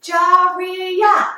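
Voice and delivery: "Jaria" chanted loudly in three beats; "Jaria" high and loud, each syllable held. Shown as a demonstration. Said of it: "we'll do three sparkling hands".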